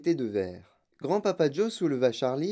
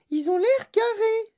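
put-on very high, loud child character voice; Veruca Salt; 6 dB louder than usual. A narrator's reading. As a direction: protest in a piercingly high voice. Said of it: "dit Veruca Salt". (voix suraiguë de fillette qui proteste)

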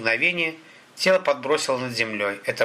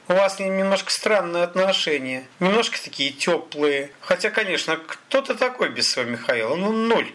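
sneering thug in a raised, sing-song voice; mocking thug; 6 semitones higher than usual. A narrator's reading. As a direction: needle the captive with mock insinuation. Narrator plays mocking thug Sakhalin.

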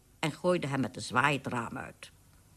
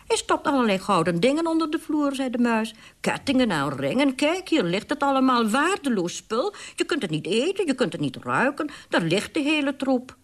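scornful widow mouse; 8 semitones higher, 4 dB louder than usual. Acted.

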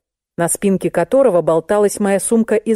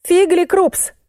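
much higher-pitched puzzled read-out; 12 semitones higher, slower than usual. A narrator's reading. Client reads a strange label to narrator, high-voiced. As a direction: read the odd foreign words out high.

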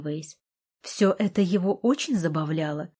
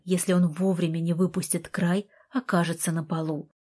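usual speaking voice

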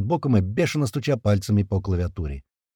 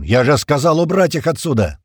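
loud, higher roar of command; angry roar; 5 semitones higher, 6 dB louder than usual. A narrator's reading. Tuman repeating his order to clear the hall, roaring. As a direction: bellow an order already given once. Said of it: "взревел Туман".

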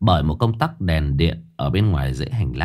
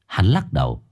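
plain reading voice

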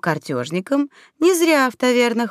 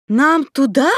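louder, higher, incredulous query; higher-pitched wondering question; disbelieving question; 5 semitones higher, 5 dB louder, slower than usual. A narrator's reading. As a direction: ask it as if you can't believe it. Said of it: "Всё ещё не веря в чудо, уточнила".

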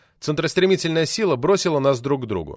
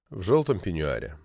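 low, soft echo falling away on ПЕНЬЮАРЕ; understated low narrator's afterthought; wry echo; 7 semitones lower, 5 dB quieter than usual. A narrator's reading. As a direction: let the phrase sink quietly to a close. Narrator wryly echoes wife's words.

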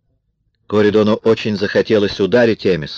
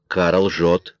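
usual speaking voice

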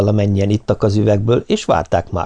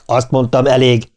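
firm interrupting command; cutting in; 3 semitones higher than usual. A narrator's reading. Ken breaks up the fight, firm interruption. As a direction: cut across the quarrel firmly. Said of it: "vágott közbe Ken".

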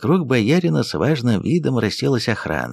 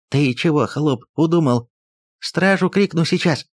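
stammering babble; 7 semitones higher than usual. Acted. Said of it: "заикаясь, пролепетал".

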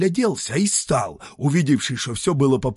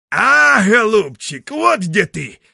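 a loud, high joyful cry; exclaiming; 9 semitones higher, 8 dB louder than usual. Acted. Grandfather cries out in joy on finding the cauldron. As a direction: shout it out, caught it at last. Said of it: "вскрикнул дед".

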